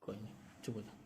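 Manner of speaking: very soft, low hush to someone crying; gently calm a crying person, almost under the breath; soft consoling. Real, not acted. (बहुत धीमे, प्यार से चुप कराते हुए)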